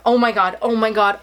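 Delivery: ordinary talk, nothing marked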